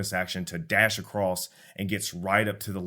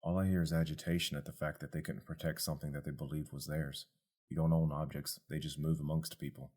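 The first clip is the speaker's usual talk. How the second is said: low, softer other voice; detached forest philosopher; creature Tuft; 3 semitones lower, 6 dB quieter than usual. Acted.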